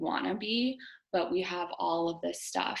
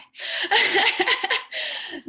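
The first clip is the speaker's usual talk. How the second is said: high, loud burst of laughter; 13 semitones higher, 9 dB louder than usual. Real, not acted. Host laughing after warning her group about crying, high loud laugh. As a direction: break into a long, high laugh.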